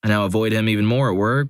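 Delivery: ordinary delivery, nothing marked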